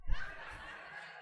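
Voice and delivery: airless high-pitched giggle; high breathy laugh. Retold or imitated. Preacher retells laughing at his sick wife, high giggle. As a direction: burst into a squeaky silent laugh. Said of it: "and I just went".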